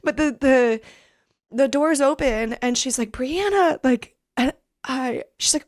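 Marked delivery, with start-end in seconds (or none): 3.1-3.8 high, emotional call of a name; joyful call. Retold or imitated. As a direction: cry out her name in joy. Mom calls name retold.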